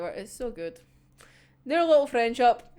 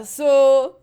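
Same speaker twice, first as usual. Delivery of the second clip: ordinary talk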